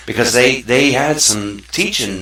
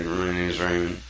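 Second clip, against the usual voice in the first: soft, mumbled name; timid self-introduction; 4 semitones lower, 8 dB quieter than usual. Shown as a demonstration. Teaching kids to speak, a shy mumbled intro. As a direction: say your name meekly, almost to yourself. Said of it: "or do I say".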